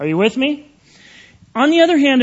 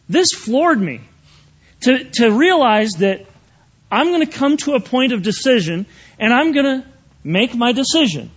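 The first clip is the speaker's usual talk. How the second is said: ordinary delivery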